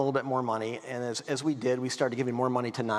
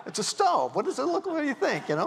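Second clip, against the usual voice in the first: very high, mocking put-on voice; sarcastic retort; 13 semitones higher than usual. Host to guests mocking their question, high sarcastic voice. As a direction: toss it back with mock indignation. Defending hideous stove to visitors.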